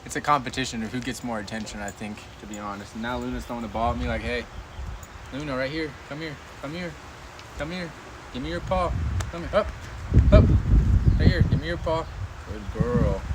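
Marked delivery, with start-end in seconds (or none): 4.2-13.2 quiet dog commands. Real, not acted.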